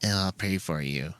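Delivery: soft, preachy placating tone; mock pious pray-for-you voice. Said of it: "they get placaty on you".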